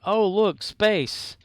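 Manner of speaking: high, loud mock discovery; mock delighted surprise. Coworkers discovering an empty shelf, mock delighted. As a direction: gasp it like a happy find. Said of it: "they're like".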